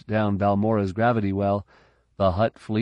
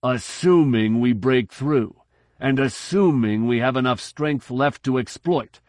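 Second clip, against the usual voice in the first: tense retorting admiral; 4 semitones higher, 3 dB louder than usual. A narrator's reading. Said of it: "Ritobo retorted".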